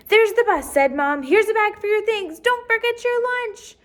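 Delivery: high, loud rushing; hurried mom. Acted. Mom rushing Jessica to the bus, high and loud. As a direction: hurry her out the door.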